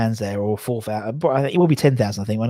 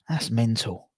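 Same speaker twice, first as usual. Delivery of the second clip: whispered, no voice at all; 2 semitones lower, 5 dB quieter, slower than usual. Pretend. Echoing his own remark to listeners, whispered. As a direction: repeat it as a hushed aside. Same words, then whispered.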